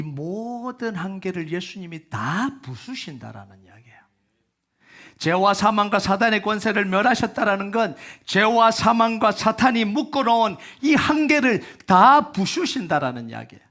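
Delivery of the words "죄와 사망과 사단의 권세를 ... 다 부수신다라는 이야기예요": louder, rising proclamation of victory; full-force preaching. Acted. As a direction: preach it out to the whole congregation.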